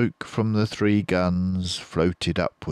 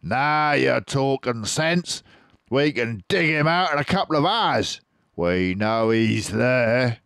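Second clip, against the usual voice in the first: Boggis the farmer; 7 semitones higher, 5 dB louder than usual. Acted. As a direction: agree heartily as Boggis. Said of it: "said Boggis".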